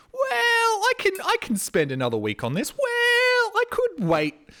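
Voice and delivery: mock lazy self. Vlogger mocking his procrastinating self in a squeaky high voice. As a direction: chirp your own excuses like a cartoon.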